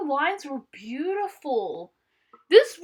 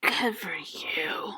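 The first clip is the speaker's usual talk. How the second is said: whisper; 8 semitones lower, 7 dB quieter, slower than usual. Real, not acted. Whispered aside.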